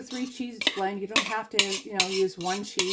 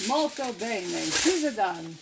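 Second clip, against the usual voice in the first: hammed-up Italian accent, last words drawn out; slower than usual. Cook goofing in the kitchen, mock Italian accent. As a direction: play the jokey Italian cook. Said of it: "I could do my Italian accent".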